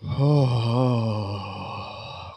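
long shaky breath out, soft; calming sigh. Acted. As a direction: sigh to calm yourself down. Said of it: "So that's what I'm doing".

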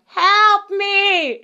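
high, drawn-out mock wail of help me; imitating a moaning patient down the hall; plaintive cry for help. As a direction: whimper it high like a helpless old patient. Patient calling out from behind.

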